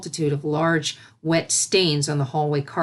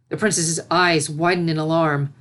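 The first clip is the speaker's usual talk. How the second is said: ordinary delivery, nothing marked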